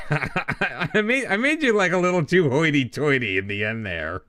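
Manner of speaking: high-pitched, laughing as you talk. Real, not acted. Streamer amused by own bad accent, laughing. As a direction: talk through real laughter.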